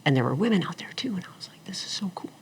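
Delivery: unvoiced, soft whisper of wonder; hushed awe. On the carrier deck, whispering in awe.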